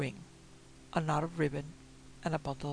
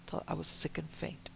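ordinary delivery, nothing marked

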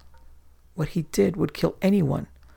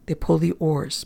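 usual speaking voice

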